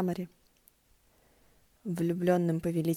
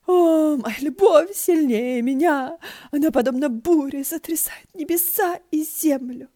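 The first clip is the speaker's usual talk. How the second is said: much higher, louder, slow theatrical howl; wailing stage actor; 8 semitones higher, 9 dB louder, slower than usual. Acted. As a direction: ham it up like a bad street actor. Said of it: "актёр за занавесом завывал".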